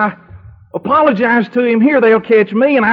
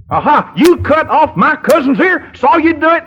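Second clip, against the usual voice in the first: accusing guard; 8 semitones higher, 3 dB louder than usual. Acted. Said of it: "Bad timing, [name]".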